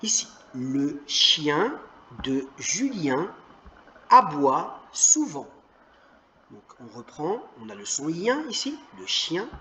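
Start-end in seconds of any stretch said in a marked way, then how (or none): 0.0-5.5 mots détachés, très lentement; lecture lente. Shown as a demonstration. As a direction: lis lentement pour la classe. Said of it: "Très bien". (lecture modèle très lente)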